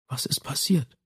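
soft, hushed question; whispered. A narrator's reading. She asks the man what has happened, whispering softly. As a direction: ask it under your breath. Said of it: "flüsterte sie".

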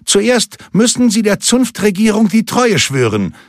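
high, insistent call of a guard. A narrator's reading. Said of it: "rief einer der Wächter".

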